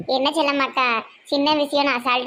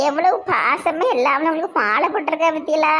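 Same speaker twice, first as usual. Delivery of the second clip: usual speaking voice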